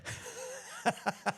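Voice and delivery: silent, wheezing laugh; soundless laugh. Real, not acted. Agent laughing about the rancher's sudden turn, silently. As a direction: laugh with no voice at all.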